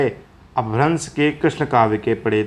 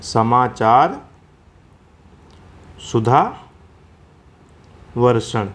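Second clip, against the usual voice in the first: सुधावर्षण drawn out very slowly, syllable by syllable; slow spelled-out name; much slower than usual. Shown as a demonstration. Teacher slowly spelling out the newspaper name for students. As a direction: say समाचार, pause, then stretch सुधावर्षण slowly for students. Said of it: "वैसे इसका नाम है पूरा".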